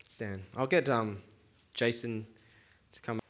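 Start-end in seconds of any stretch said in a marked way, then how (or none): none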